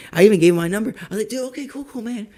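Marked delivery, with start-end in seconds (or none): 1.3-2.2 mock polite brush-off.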